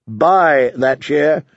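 "by" drawn out and leaned on; slow, weighty "by", correcting the picture; stress on BY. Acted. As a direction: hit "by" hard and long. Contrast with "in that chair.

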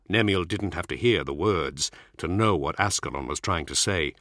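ordinary delivery

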